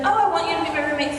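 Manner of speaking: ordinary delivery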